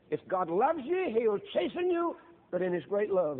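full-force preaching. Acted. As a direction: lift the warning into a ringing cry. Preacher rises to a cry.

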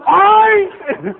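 long, very high, loud squeal of an oink. Retold or imitated. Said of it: "sound like a pig".

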